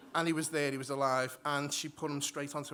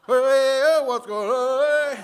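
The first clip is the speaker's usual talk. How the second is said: high, loud, slow proud call; big proud hey, hey; mock Lion King cry; 9 semitones higher, 14 dB louder, much slower than usual. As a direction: hold the baby up and hail him. Lion King baby lift.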